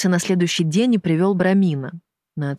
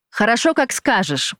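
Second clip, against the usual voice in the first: higher-pitched, mild cry of surrender; understated panicked agreement; desperate cry; 6 semitones higher than usual. A narrator's reading. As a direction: give in with a restrained outcry. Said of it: "вскрикнул он".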